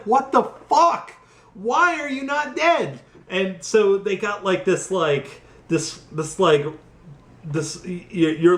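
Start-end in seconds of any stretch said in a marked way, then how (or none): 0.0-3.0 high, strained incredulous outcry; mock exasperated disbelief. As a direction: play a hero who can't believe it, pitched way up.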